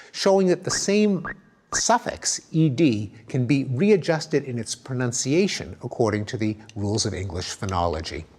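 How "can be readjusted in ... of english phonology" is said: ordinary talk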